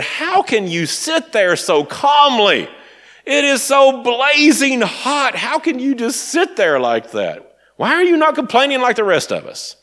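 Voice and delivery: high, drawn-out incredulous whine, acted out; exasperated complaint. Actress to calm co-star in blazing heat, acted exasperated disbelief.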